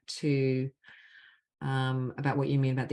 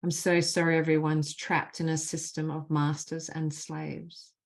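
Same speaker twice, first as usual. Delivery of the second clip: measured, deliberate leading of a phrase; guided healing phrases; 2 semitones higher than usual. Acted. Facilitator gives the group apology phrases to repeat, measured and deliberate. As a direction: lead the group line by line, leaving space after each.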